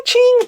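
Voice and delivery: very high, loud sword ching; katana clang. Retold or imitated. Sword fight with the woman, loud high clangs. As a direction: ring out like clashing blades. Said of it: "everything's ching".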